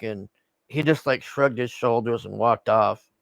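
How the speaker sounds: ordinary talk, nothing marked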